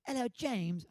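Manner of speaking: high-pitched British greeting; British accent. Retold or imitated. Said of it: "I do a great British accent".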